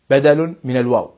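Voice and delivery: ordinary delivery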